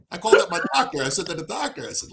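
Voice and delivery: usual speaking voice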